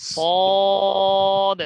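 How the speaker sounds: সদ loud, with a long level rounded vowel; heavy, drawn-out. Shown as a demonstration. Teacher leading repeat-after-me, long heavy সদ. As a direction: stretch সদ as thick as you can. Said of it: "যে যত মোটা করতে পারেন".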